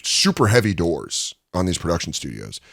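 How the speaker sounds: usual speaking voice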